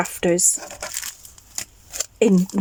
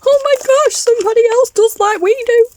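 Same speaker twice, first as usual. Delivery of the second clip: much higher, louder, squealing with joy; delighted squeal; 6 dB louder than usual. Retold or imitated. Retelling spotting the handmade journals at the stall, delighted squeal. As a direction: squeal like you found a kindred spirit. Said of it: "I'm like".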